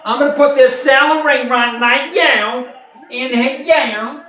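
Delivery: high, loud nasal Southern cooking-show voice; a Southern TV cook greeting her fans; Paula Deen impression. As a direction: ham up Paula Deen talking through her nose. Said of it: "That's how she talks, with her nose".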